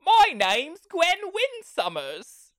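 high, loud, drawn-out mock introduction; hammed-up heroine. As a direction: stretch the fake name out in a high parody voice. Mocks the blurted false name.

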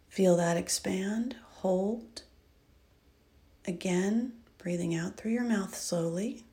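soft, hushed breathing guidance; soft spa whisper. Acted. Leads a breathing exercise.

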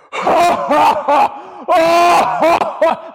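loud, high imitated belly laugh; keel over laughing. Mimicking his mentor doubled over laughing, in a loud, high laugh. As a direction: burst out in a big shrieking laugh. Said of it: "Like, keel over laughing".

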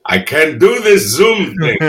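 a hint of an old father grumbling; dad's quote. Imitating his eighty-four-year-old dad refusing Zoom, mock grumble. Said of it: "he's eighty-four and he went".

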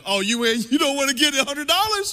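high, loud indignant outburst; matching his frustration. Retold or imitated. Pastor voicing his inner reaction to the man, indignant high yell. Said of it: "I match his energy".

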